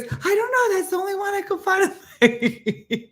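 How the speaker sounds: high voice breaking into laughter; laughing quote. Retold or imitated. Quoting Wendy, laughing.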